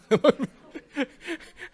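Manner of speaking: loud, high burst of laughter; amused laugh. Real, not acted. Lecturer cracking up at his friend's taunt, laughing.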